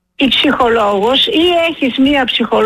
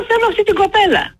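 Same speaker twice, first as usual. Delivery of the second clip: high, fast, insistent retold demand; 10 semitones higher, faster than usual. Caller retelling how she demanded the weekend operator, high and insistent. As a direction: insist on the girl you trust, high and fast.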